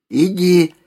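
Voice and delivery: high hushed crone command; old woman whispering. A narrator's reading. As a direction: breathe the order like an old hag. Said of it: "шепнула она".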